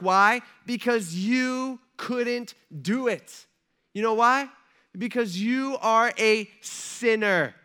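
high, loud preacher driving each point home; emphatic preaching. Real, not acted. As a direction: preach it forcefully, leaning hard on key words.

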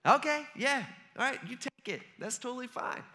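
high-pitched, mock-agreeable go-ahead; acted casual okay; easy acceptance. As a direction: wave them on, imitating easily convinced bystanders. Bystanders readily accept the excuse.

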